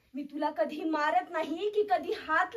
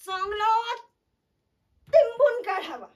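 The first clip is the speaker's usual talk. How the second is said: loud, raised-pitch threat; angry outburst; 4 semitones higher, 8 dB louder than usual. Acted. Mother wanting to thrash her child, angry outburst. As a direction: burst out louder on the threat.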